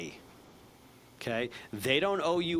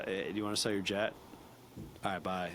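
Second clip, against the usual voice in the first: unengaging sales call; 4 semitones lower, 5 dB quieter than usual. Shown as a demonstration. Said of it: "not just, uh".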